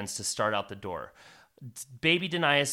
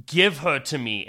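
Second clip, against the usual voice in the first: louder, hard command; a sorcerer demanding the child; commanding villain; 3 semitones higher, 8 dB louder than usual. Acted. Galdrin commands.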